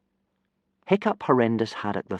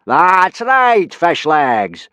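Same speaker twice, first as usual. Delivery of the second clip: loud, slow, higher bellow; booming; 9 semitones higher, 11 dB louder, much slower than usual. Acted. Teacher booming down at a student. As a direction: boom it out, pleased with himself. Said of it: "boomed Gobber".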